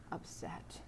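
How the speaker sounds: whispered word. Acted. Preacher whispers about Martha's fear. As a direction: breathe the word out.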